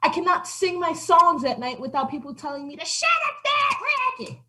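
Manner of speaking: milk the pity for sympathy; self-pitying old cat. Acted. Old cat pities herself.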